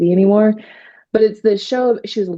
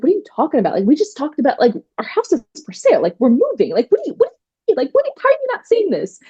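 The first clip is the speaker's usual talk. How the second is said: much higher, rising, incredulous protest; exasperated disbelief; 8 semitones higher, faster than usual. Retold or imitated. Wife arguing back at her angry husband, climbing much higher in exasperated disbelief. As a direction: cannot believe he does not get it.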